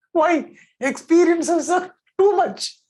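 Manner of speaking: laugh, then whine it out slowly; high laughing voice. Acted. Joking about the hard experiences.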